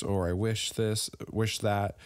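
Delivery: usual speaking voice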